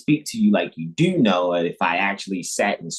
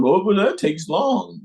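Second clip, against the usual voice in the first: whiny mock complaint; 10 semitones higher than usual. Mocking an impatient listener.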